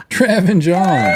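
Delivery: big, slow, high-pitched intro; announcer introduction. Real, not acted. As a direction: bring them on like a ring announcer. Host introduces the brothers on stage.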